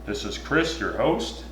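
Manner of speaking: mock sports announcer intro; hammed-up announcer voice. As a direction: announce yourself like a TV host. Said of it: "Sounds like a, a T V, uh, announcer".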